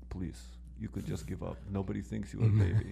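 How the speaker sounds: low, soft mock Dagestani accent whispered in an ear; Khabib accent. Podcast friend imagining Khabib consoling Conor, soft mock accent. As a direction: coo it softly in a put-on Khabib accent. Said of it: "going to be whispering in his ear".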